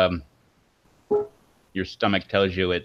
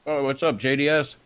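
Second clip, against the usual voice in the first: chewing while talking; mouth full; 5 semitones higher than usual. Real, not acted. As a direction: talk around a mouthful of jerky. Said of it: "Sorry, chewing".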